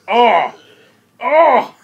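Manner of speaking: loud, high cry of being stabbed; two big impact groans; pained cry. Acted. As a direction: cry out as if struck in the chest. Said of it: "he's like".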